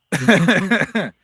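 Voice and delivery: loud burst of laughter; laughing. Real, not acted. Laughing at the bug episode.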